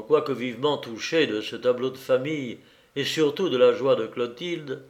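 usual speaking voice

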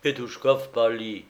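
plain reading voice

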